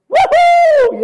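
high, loud celebratory whoop; joyful whoop. Real, not acted. Preacher whooping for joy at God's protection. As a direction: let out a big happy woohoo.

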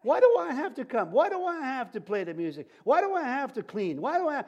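preacher mocking members who complain about serving; whiny griping. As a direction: whine like a put-upon church worker. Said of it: "Everyone said".

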